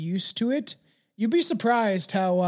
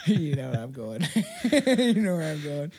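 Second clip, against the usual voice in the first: talking through giggles; laughing. Real, not acted. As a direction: crack up at your own joke while speaking. Cracking up at his own joke.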